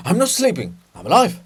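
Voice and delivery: loud startled denial; startled protest. Acted. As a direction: snap awake protesting. Poet startled awake.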